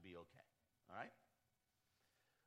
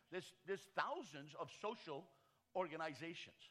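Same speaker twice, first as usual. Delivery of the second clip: raised, louder preacher's cadence; preaching at full force; 6 semitones higher, 7 dB louder than usual. Acted. Pastor rallying church leaders, preaching at full force. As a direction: drive it home like a pulpit sermon.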